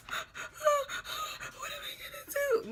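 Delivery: gasping, very high squeaky wail; hammed-up sobbing, words drawn out slowly; mock crying. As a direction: imitate a helpless, high-pitched bawl. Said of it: "I'm crying again. Like, I'm just like".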